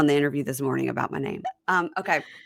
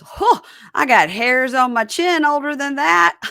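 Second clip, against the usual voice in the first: loud, higher joking dad with a big laugh; dad joke; 8 semitones higher, 8 dB louder than usual. Retold or imitated. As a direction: crack yourself up as a corny dad. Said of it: "it was a stupid dad joke".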